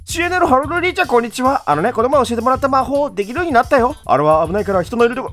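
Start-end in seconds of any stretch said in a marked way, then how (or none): 0.1-3.9 put-on high eager little kid's voice; excited kid. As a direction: chirp it like an excited child. Child NPC voiced.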